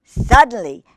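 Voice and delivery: usual speaking voice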